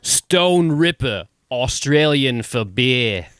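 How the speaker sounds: put-on slow, drawn-out Australian accent; Aussie beer-ad voice. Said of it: "Can you do that in an Australian accent?".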